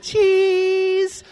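high, loud, long-held "cheese"; children posing for photo. Retold or imitated. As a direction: squeal it out like kids facing a camera.

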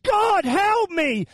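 high, drawn-out cry to God; acted anguished wail, slowly easing off; desperate prayer. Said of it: "has anyone ever prayed that prayer".

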